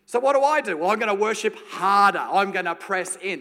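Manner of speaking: loud, high, pushing harder; mock straining to press in harder; worship harder. Preacher hamming up trying harder, loud and high.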